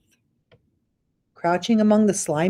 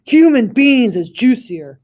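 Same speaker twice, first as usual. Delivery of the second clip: loud, higher giant with gusto; gruff giant; 5 semitones higher, 9 dB louder than usual. Acted. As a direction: savour the word human. Bloodbottler retorts.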